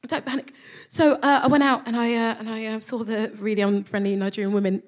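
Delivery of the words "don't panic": soft, repeating to herself; calm yourself down quietly; self-calming. Retold or imitated. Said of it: "And so I thought".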